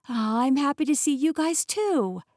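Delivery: high-pitched fussing over pets; happy, lifted pet-talk voice; affectionate greeting. Acted. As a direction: coo to the dogs. Greets the excited dogs.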